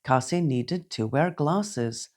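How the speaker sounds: ordinary delivery, nothing marked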